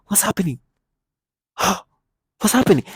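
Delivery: mock gasp then high what's happening; fake gasp. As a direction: gasp as if shocked, hammed. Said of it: "act like you don't know".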